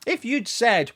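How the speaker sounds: ordinary talk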